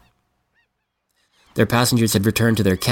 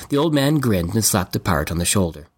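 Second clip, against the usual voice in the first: ordinary delivery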